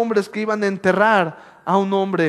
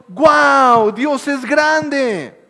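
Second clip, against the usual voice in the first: higher, louder, slow shout of awe; amazed shout; 7 semitones higher, 7 dB louder, much slower than usual. Acted. Preacher acts the crowd seeing the sea split, shouting. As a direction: cry out in wonder at God.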